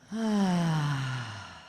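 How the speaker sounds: dramatic sigh. Real, not acted. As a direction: sigh it all out, letting go. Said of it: "One more time".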